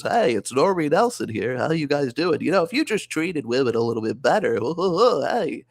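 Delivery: cheerful normie giving dating advice. Acted. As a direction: chipper nice guy lecturing incels. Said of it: "I have the normie voice, which is".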